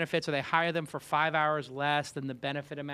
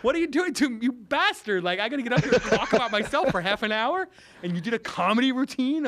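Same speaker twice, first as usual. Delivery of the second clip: higher and louder, laughing through a mock protest; hammed-up laughing outcry at the man who just did stand-up; mock outrage; 8 semitones higher, 6 dB louder than usual. After Dick's comedy set, host protests on stage, laughing mock outrage. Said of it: "I'm like".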